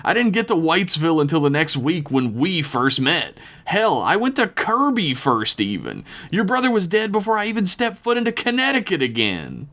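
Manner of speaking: high-pitched indignant bellow. A narrator's reading. Rio giving Jason his alibi, high indignant bellow. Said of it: "Rio bellowed, pointing at Jason".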